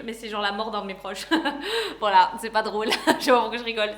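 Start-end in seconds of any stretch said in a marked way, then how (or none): 1.3-1.9 short uneasy giggle; nervous laugh. Real, not acted. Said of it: "c'est un rire nerveux". 2.0-3.9 laughing through the words nervously. Real, not acted. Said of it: "c'est un rire nerveux".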